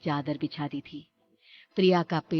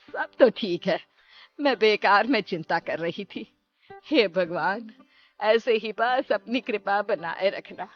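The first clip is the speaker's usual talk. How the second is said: louder voice, easing into prayer; relieved mother-in-law; 2 semitones higher, 6 dB louder than usual. Acted. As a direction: sigh off the worry, then pray slowly. (राहत भरी सास की प्रार्थना)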